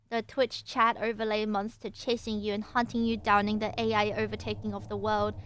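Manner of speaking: normal talking voice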